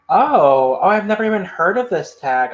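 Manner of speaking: ordinary talk, nothing marked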